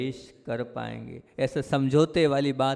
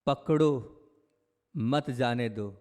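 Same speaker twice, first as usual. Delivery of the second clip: पकड़ो alone, long pause, then a slow "मत जाने दो"; pause after पकड़ो; slower than usual. Shown as a demonstration. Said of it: "यह बात संसार की है".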